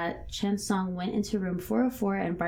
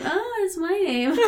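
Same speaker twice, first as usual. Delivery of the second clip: much higher, playful put-on voice; imitated Andrew; 10 semitones higher than usual. Podcaster mimicking a character hearing his name, high playful voice. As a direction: pipe it up in mock delight. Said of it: "Andrew's like".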